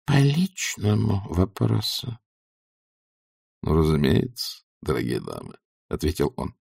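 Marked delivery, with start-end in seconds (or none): 0.1-2.2 slow, softened stage whisper; conspiratorial whisper. A narrator's reading. Matushka confiding a private matter, in a conspiratorial whisper. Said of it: "добавила заговорщицким шёпотом". 3.6-5.6 low, soft, courtly reply; suave actor. A narrator's reading. Actor agreeing to a private talk, low and suave. Actor replies to whispered request.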